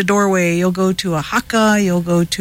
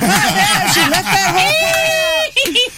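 high, laughing complaint; 13 semitones higher, slower than usual. Real, not acted. Telling the radio host her friend hid the key detail, laughing. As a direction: laugh it out, half shouting.

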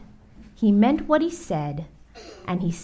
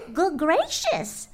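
high, slow gasp of surprise; startled bird, pitched up; Maisie gasping; 7 semitones higher, slower than usual. Acted. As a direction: draw it out in high-voiced shock. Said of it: "gasped Maisie".

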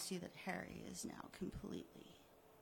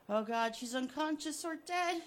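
higher and louder in panic; horrified cry; 7 semitones higher, 7 dB louder than usual. Acted. Harry watching Becky drown, horrified. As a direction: gasp it out in horror.

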